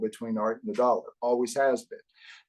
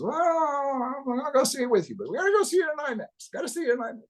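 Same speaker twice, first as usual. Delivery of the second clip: much higher, fast New Jersey insistence; New Jersey accent; faster than usual. Retold or imitated. Producer friend insists on IMAX, high New Jersey voice. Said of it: "he's got a New Jersey accent".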